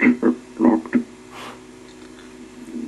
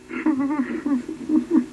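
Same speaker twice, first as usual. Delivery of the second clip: squeaky high giggle, a little soft; high goblin giggle; 4 dB quieter than usual. A narrator's reading. Goblin laughs in story.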